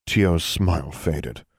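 ordinary delivery, nothing marked

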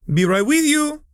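high, bright call from the back room; chipper call. Acted. Shopkeeper calling to arriving customers, chipper. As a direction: call out cheerfully from another room. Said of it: "a chipper voice called out".

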